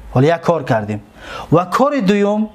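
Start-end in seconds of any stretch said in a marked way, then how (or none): none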